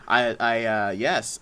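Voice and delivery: normal talking voice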